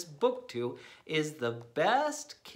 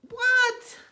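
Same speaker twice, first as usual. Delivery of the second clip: much higher, loud shocked yelp; shocked shriek; 8 dB louder than usual. Pretend. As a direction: squeal in disbelief. Shocked outburst.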